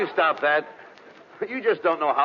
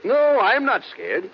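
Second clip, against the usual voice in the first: slow, loud forced bravado; insisting he's not scared, too slowly; mock brave; 6 semitones higher, 4 dB louder, much slower than usual. Denies fear, biting nails.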